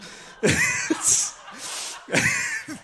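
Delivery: high, unvoiced burst of laughter; a real laugh breaking out; breathy laugh. Real, not acted. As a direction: let out an airy laugh at yourself. Laughing at own reluctance.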